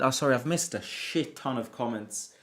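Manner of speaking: usual speaking voice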